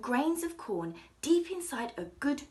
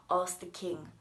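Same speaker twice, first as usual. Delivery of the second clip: plain reading voice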